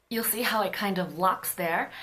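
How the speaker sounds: ordinary talk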